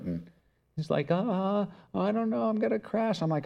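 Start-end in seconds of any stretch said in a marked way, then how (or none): 1.1-3.2 scared little daughter. Retold or imitated. Daughter afraid on bike.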